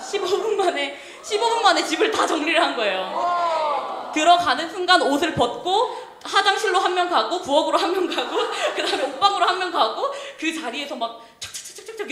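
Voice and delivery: high, loud, amused amazement; excited storytelling. Real, not acted. 청소 기적을 신나게 재연.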